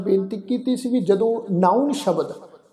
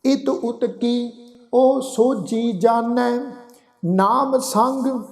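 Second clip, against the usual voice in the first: very slow, drawn-out Gurbani recitation; scripture recitation; 3 semitones higher, much slower than usual. Acted. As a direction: recite each phrase slowly, holding the long vowels. Recites scripture between explanations.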